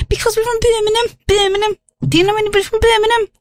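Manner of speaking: high, put-on Brummie accent, quick on the question; hammed-up Brummie voice; Birmingham accent. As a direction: play up a broad Birmingham accent. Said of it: "They've got such a Gunda accent".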